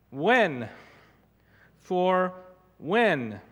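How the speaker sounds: "when" hit hard and falling, set apart by pauses; stress on when. Shown as a demonstration. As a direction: lean on "when" as a question. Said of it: "I'm emphasizing the word when".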